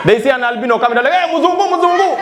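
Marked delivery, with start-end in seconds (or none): 1.1-2.2 high shouted hail; much higher street call. Retold or imitated. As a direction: hail the passerby eagerly. Riders calling out to albino.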